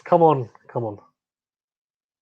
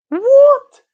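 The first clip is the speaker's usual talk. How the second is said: shrieked high, loud disbelief; stunned high cry of disbelief; outraged what; 9 dB louder than usual. Real, not acted. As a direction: yelp it in outrage at the umpire. Umpire misses a wide.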